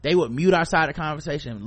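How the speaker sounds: ordinary delivery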